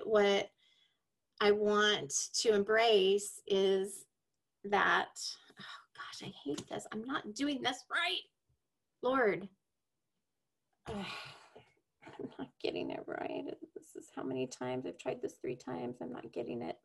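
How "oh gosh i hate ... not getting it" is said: hushed, exasperated self-talk; soft, defeated muttering under the breath; frustrated and near-whispering, giving up on the take; real frustration. Real, not acted. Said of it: "hear the frustration in my voice".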